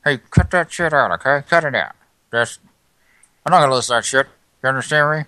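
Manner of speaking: loud scolding, fed up; angry. Acted. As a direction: tell him off sharply. Yells back at rude caller.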